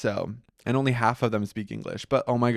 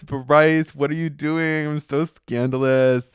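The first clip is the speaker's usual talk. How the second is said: mock self-scolding; 4 semitones higher, 4 dB louder, slower than usual. Scolding herself over a risky topic.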